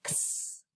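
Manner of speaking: X said as a whispered unvoiced ks; letter sound x. Shown as a demonstration. Teacher models the X letter sound, whispered ks. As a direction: breathe out a soft ks for X. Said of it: "we know it says".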